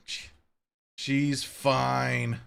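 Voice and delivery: ordinary talk, nothing marked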